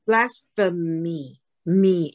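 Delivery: "Blasphemy" very slowly, ending on a long mee; final "me" sound. Shown as a demonstration. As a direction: isolate the last syllable of "Blasphemy" as "me". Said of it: "因为它M O I".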